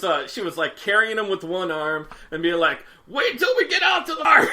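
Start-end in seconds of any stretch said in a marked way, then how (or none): 3.1-4.5 high mock-furious shout; imitated Hulking-out mom. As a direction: holler a threat like a raging mother. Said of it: "she did Hulk out".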